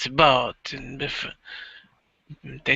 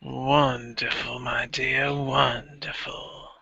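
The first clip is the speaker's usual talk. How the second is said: pleased father voice; 5 dB quieter, slower than usual. Acted. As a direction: savour each word like a proud patriarch. Father answers daughter on phone.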